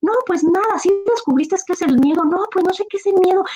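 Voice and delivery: put-on high, innocent young man's voice; naive Juan. Juan tells the master he still knows no fear, high and innocent. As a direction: shrug it off, clueless and sweet.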